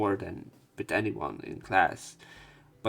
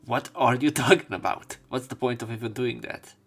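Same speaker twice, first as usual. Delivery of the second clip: indignant retort; 7 dB louder than usual. Acted. Protagonist answering a teasing junior, indignant. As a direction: push back, loud and incredulous. Acted protest to a tease.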